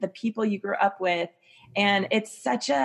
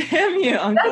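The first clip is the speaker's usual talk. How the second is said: laughing; 8 semitones higher, 5 dB louder than usual. Real, not acted. Echoes the joke while laughing.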